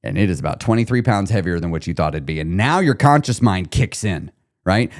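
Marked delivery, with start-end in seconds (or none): none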